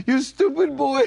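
loud, high scolding shout; angry scolding. Retold or imitated. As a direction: yell it at a careless boy. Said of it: "he was going".

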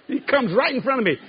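ordinary talk, nothing marked

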